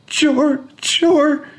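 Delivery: put-on breathless crying boy; sobbing child. A narrator's reading. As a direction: gasp out his name through tears.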